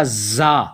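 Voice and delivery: زا said hard and slow, strong buzz. Shown as a demonstration. Teacher demonstrating a firm زا. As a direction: press زا out firmly and slowly. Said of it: "زا کو اتنا ہی سختی سے پڑھنا ہے".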